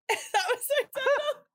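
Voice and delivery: giggling the words out, pitch jumping high; laughing. Real, not acted. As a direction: laugh through it, embarrassed at yourself. Laughing at her own stream story.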